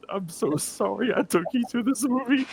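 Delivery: high-pitched, sheepish apology; mortified apology. Retold or imitated. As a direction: squeak out an embarrassed apology. Acted apology to her mom.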